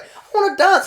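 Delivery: whiny friend. Retold or imitated. Tipsy friend insisting on dancing, whiny. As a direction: whine like a tipsy party girl. Drunk friend whining in story.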